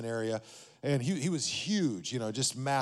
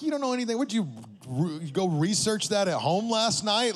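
incredulous retort; 6 semitones higher, 3 dB louder than usual. Retold or imitated. Mocking trainer's Bible claim.

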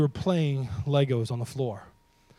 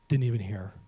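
ordinary talk

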